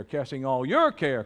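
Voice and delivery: emphatic "your", the rest held back; stress on your. Shown as a demonstration. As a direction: lift and hit the word "your". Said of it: "Say it with emphasis".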